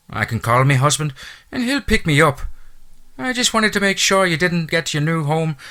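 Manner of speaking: a chatty Irish housekeeper's brogue; thick Irish brogue. A narrator's reading. Irish housekeeper welcoming the new owner, thick Irish brogue. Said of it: "her lyrical accent".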